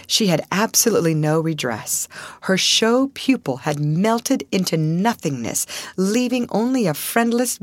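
plain reading voice

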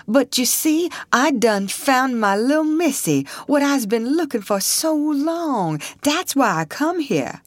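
put-on high, earnest pleading old man; humble old man. A narrator's reading. Old man explaining himself to the soldier, earnest and high.

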